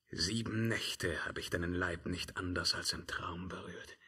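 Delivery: hushed, breathless whisper. Acted. Emperor whispering to himself about the witch. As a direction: whisper it to yourself, almost lost. Emperor recalls seven nights apart.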